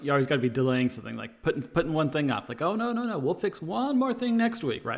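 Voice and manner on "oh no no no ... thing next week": high, laughing mock reassurance; stalling doctor. Doctor stalling a vampire patient to stay alive, high mock reassurance. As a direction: put off the patient in a raised voice. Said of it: "So you gotta be like".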